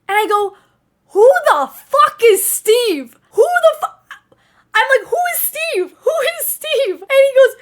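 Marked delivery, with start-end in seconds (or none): 1.1-7.0 high, loud, confused who is Steve; baffled shriek. Retold or imitated. Sister yelling back at her brother in disbelief, high baffled shriek. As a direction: squeal it in total confusion. Panicked reply about unknown Steve.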